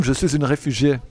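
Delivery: ordinary delivery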